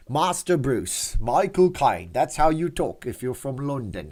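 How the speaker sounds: slow, hammed-up Cockney accent; mock Cockney accent. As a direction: put on a deliberate fake London Cockney. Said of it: "Cockney British accent".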